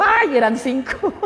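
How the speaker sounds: high "ay" breaking into laughter; laughing dismay. Retold or imitated. Driver telling the pump attendant of a mistake, laughing. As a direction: laugh through the embarrassed realization.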